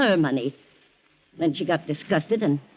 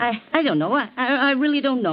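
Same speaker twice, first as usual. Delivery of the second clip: flustered; 7 semitones higher than usual. Acted. Housekeeper cornered by captain.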